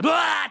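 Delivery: startled yelp. Acted. Brahmin grabbed by the tiger.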